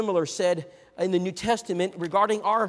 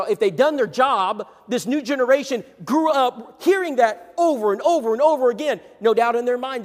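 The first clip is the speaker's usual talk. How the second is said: louder, driving sermon delivery; preaching at full force; 5 semitones higher, 7 dB louder than usual. Acted. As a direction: press the point home to the congregation.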